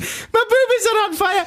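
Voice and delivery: very high, giggly Scottish falsetto; hammed-up nanny in a panic; Missus Doubtfire. Presenter copying the co-host, squealed bad film impression. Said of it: "if you can do Missus Doubtfire".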